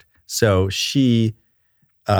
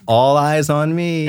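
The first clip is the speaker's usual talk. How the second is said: teacher call for attention; 8 semitones higher, slower than usual. Retold or imitated. Author showing classroom trick to kindergartners, slow teacher call. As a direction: call the class to order, drawn out. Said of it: "there's all those little tricks".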